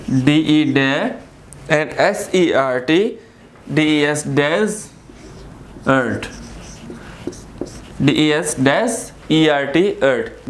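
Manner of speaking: slow spelling, letters then the sound they make; teacherly letter-by-letter breakdown; spelling out syllables. Shown as a demonstration. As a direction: spell each part of desert, pausing before sounding it. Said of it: "क्या है ये desert का word".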